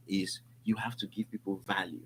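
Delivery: softly stressing the key lesson; confiding point. Real, not acted. [name] giving his key relationship tip, soft emphasis. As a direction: lean in and say it almost hushed. Key advice dropped low.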